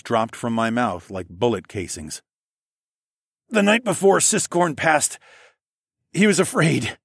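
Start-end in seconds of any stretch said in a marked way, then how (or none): 3.5-7.0 strained, higher and louder through the pain; gritted out. Acted. Narrator forced to testify, gritting out words. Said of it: "I gritted out".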